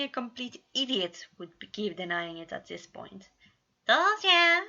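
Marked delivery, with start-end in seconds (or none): 3.9-4.6 high, loud gloating grin; gleeful grin. A narrator's reading. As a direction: crow it, grinning. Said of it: "Remus grinned".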